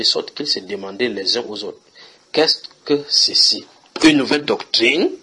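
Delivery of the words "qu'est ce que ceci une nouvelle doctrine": slow, louder astonished question; amazed crowd. Acted. Preacher voicing the stunned synagogue crowd, slow and amazed. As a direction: ask it in wonder, drawn out.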